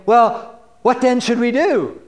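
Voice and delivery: a sincere listener asking the prophet; mock-eager plea from the crowd; earnest crowd member. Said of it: "who's gonna go out to this guy and say to him".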